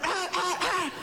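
soft imitated barks; mock barking. Preacher imitating a little dog barking at mirrors. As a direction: bark lightly like a small dog. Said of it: "Empezó a ladrar".

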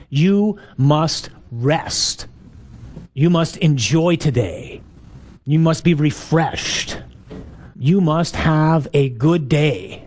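very slow, word by word; emphatic command. Shown as a demonstration. Preacher spelling out the commandment to the congregation, slow and emphatic. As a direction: lay down each command slowly, with pauses between words.

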